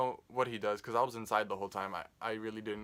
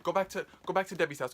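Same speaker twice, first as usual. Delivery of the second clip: high, rushed, louder mock panic from grandma; panicky grandma; 6 semitones higher, 4 dB louder, faster than usual. Grandma panicking in the car, high fast pleading. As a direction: imitate grandma urgently begging to turn back. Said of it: "And she was like".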